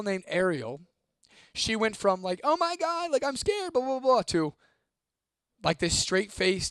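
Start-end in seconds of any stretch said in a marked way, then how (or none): 2.4-4.2 high-pitched imitation of a frightened girl; put-on scared girl, pitched way up; mock scared. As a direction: squeal it out in mock panic. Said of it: "she's all scared and stuff".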